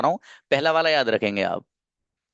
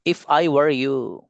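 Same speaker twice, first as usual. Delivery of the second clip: slow model phrase; much slower than usual. Shown as a demonstration. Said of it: "teacher repeats for class".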